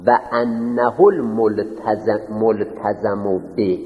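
very slow, each word held out; slow dictation. Shown as a demonstration. Teacher dictating Arabic words for students to write, very slow. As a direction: spell it out slowly for their notes. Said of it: "انه رو بزنید".

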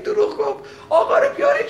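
very high laughing call; laughing mock shout. Teacher retells headman's fake alarm, laughing through the call. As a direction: crack up while yelling the call.

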